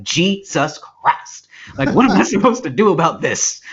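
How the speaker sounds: hammed-up preacher's exasperation; flustered Southern preacher. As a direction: exclaim like a tempted preacher losing control. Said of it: "and it's like".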